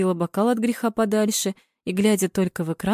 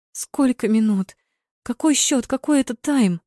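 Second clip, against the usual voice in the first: higher, urgent volley of questions; blurted out; 5 semitones higher than usual. A narrator's reading. Fan demanding the score from the TV, blurting. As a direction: fire questions urgently at the screen. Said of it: "выпалила она".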